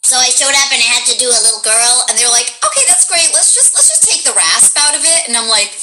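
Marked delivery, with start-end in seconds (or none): 2.6-5.3 higher-pitched imitation of a director; lightly voiced studio director giving a note; director's note. Acted director in retold session.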